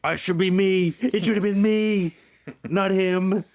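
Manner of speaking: high, slow voice, a hint of a resentful Luther; Luther's bitter grumble. Luther resenting the returning veterans, high mock bitterness. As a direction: sulk that it should have been you, drawn out and high. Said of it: "They're just sitting there simmering, getting angry".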